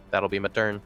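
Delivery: usual speaking voice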